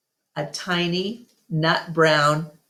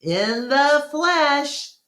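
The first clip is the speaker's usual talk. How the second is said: Bob's voice; 9 semitones higher, much slower than usual. A narrator's reading. Bob answers Ivan.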